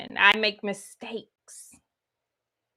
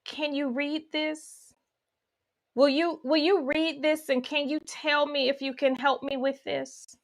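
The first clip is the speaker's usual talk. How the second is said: put-on childlike plea; 4 semitones higher, 5 dB quieter than usual. Child pleading with parent.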